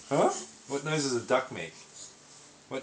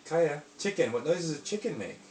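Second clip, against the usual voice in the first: ordinary delivery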